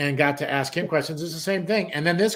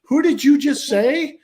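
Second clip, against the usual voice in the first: high, loud, drawn-out disbelief; jarred; 11 semitones higher, 6 dB louder, slower than usual. Retold or imitated. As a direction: act out a stunned man demanding a repeat. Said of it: "this guy literally got jarred".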